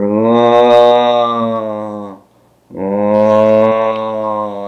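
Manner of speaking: long, droning undead moan; drawn-out groan of a zombie horde. Retold or imitated. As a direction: moan it out long like the walking dead. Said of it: "you hear more moans".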